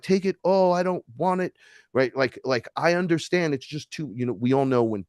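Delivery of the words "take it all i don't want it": pleading cry. Retold or imitated. Pleading to goddess.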